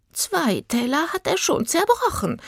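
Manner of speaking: high-pitched fussy reminder; reminding. A narrator's reading. Sister reminding her twin about broken plates, fussy. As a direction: pipe up in a high old-lady voice. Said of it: "erinnerte sie die andere".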